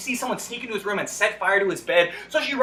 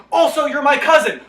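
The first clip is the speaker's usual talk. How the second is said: shouted reveal; 6 semitones higher, 7 dB louder than usual. Retold or imitated. Saint John's absurd final reveal.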